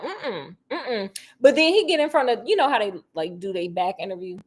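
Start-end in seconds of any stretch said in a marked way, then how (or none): 0.0-1.2 soft denying hums; mock Sonny. Imitating Sonny denying to Vea, soft hums. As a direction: shake it off quietly. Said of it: "lying his ass off".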